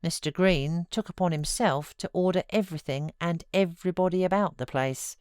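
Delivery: ordinary delivery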